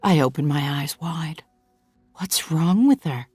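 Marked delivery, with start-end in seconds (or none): none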